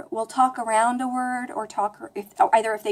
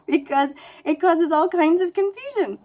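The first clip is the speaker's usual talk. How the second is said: high, laughing through the words; 5 semitones higher than usual. Real, not acted. Speaker amused at confusing her friends, laughing. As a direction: keep talking through a laugh.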